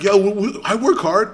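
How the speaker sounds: stammering, high and loud imitation; mock him sputtering in his defence; flustered protest.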